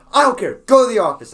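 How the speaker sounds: loud, high dismissal; yelling principal. Retold or imitated. Principal overrules a protesting student, yelling. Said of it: "he yells".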